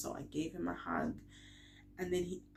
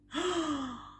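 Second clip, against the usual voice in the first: retold gasp; 10 semitones higher than usual. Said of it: "and I'm like".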